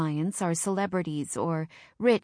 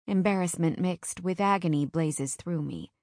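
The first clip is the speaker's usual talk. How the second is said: ordinary delivery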